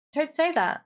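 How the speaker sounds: high, pleading objection; upset protest. Acted. Daughter objecting to her mother's words, upset.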